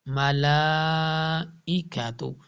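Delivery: ملائکتو drawn out slowly, long vowel after لام; Quran recitation. Shown as a demonstration. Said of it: "اس کو long کریں گے". (ملائکتو کو لمبا کھینچ کر پڑھیں)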